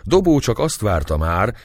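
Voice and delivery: ordinary delivery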